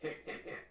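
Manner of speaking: voiceless acted snicker; low-volume villainous chuckle; gloating chuckle. Jim gloating over his monster, quiet snickering.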